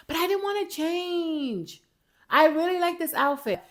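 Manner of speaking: high-pitched playful protest; mock whining. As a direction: whine it like a pretend complaint. Mock protest about changing clothes.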